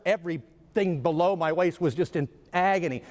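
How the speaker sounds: usual speaking voice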